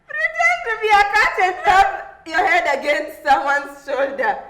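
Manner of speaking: reading through laughter; giggling while reading the dare; laughing. Real, not acted.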